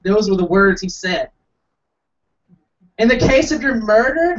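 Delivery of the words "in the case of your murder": higher, mock smug echo. Comic mocking the officer's last booking question, smugly. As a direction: repeat it in a high, smug official voice. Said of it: "with a smug accent".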